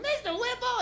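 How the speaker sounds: high laughing squeal of a name; women shrieking. Retold or imitated. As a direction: squeal it like giggling shoppers. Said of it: "they were like".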